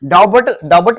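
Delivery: डाउट said as "DAA-but", b sounded; wrong pronunciation. Shown as a demonstration. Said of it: "डाउट नहीं". (डाउट को 'डाबट' बोलें)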